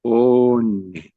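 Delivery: reading ONE slowly off a list; como leyendo. Acted. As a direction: sound out One as if searching for it. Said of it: "como leyendo". (leyendo One despacio)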